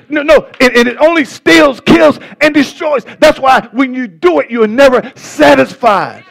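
high, urgent pulpit surge; fervent preaching. Real, not acted. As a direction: build in a heated warning to the congregation. Preacher surges on destruction warning.

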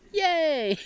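high, drawn-out mock yay; mock cheer.

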